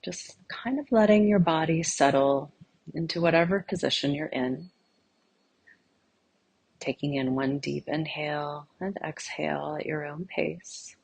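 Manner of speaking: soft, unhurried meditation voice with long pauses; guided meditation. Real, not acted. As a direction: gently guide listeners inward, leaving silence between phrases.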